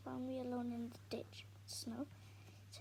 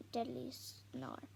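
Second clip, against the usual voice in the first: ordinary delivery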